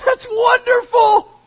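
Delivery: high, overjoyed cries of delight; excited brother. Retold or imitated. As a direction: burst with joy at the news. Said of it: "we can imagine the brother's reaction".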